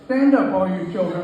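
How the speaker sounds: usual speaking voice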